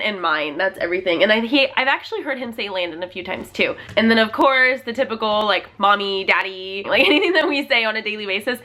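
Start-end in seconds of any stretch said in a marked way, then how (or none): none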